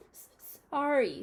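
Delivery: high, loud, slow stammered sob; a giant sobbing out a stuttered sorry; sobbed apology. A narrator's reading. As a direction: choke out the apology through tears. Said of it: "sobbed Hagrid".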